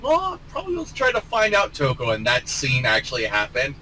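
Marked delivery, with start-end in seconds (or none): 0.0-2.1 pitched up, playful quote; high mock voice. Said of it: "I'm like".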